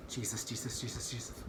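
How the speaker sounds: soft, breathy plea to Jesus; hushed prayer. Real, not acted. Turning the key, hushed prayer for the car to start. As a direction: pray under your breath for the car.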